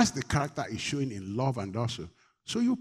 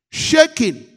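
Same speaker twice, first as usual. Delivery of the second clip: sudden high cry; 13 semitones higher, 11 dB louder than usual. Real, not acted. Describes the woman's fear.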